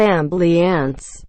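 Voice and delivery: AMBULANCE as "am-blee-ance", slowly; slow mock mispronunciation, "am-blee-ance" for ambulance; wrong pronunciation. Rejecting how ambulance workers say it, slow mispronunciation. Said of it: "It's not ambulance".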